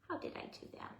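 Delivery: whispering. Real, not acted. Repeat whispered to self.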